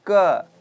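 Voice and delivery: क said loud, its vowel left open; open unfinished ending. Shown as a demonstration. Teacher drills students on the letter, loud open-ended क. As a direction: punch out क without closing off the end. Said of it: "last पूरा खत्म नहीं करना".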